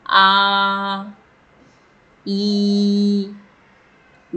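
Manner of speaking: आ, ई, ऊ each held long for three counts; prolonged vowels. Shown as a demonstration. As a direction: stretch आ, ई, ऊ out long, pluta style. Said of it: "कैसे बोलेंगे?". (आ, ई, ऊ को तीन मात्रा तक खींचकर बोलना)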